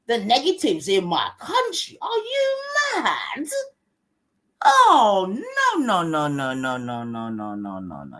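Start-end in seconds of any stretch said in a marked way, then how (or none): 2.0-3.5 slow, very high "are you mad"; incredulous outburst. Real, not acted. 4.6-8.2 mock dismay. Mock scolding, repeated refusal.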